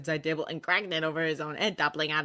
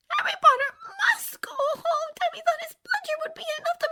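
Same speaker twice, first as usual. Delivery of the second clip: very high, whispery house-elf squeak; a tiny frantic elf pleading; Dobby voice; 6 dB louder than usual. A narrator's reading. Tearful house elf begging Harry Potter, high and whispery.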